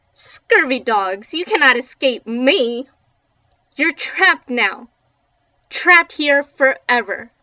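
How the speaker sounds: loud, slow pirate ghost threat; menacing pirate ghost. Acted. Pirate ghost threatening the trapped kids, loud and gloating.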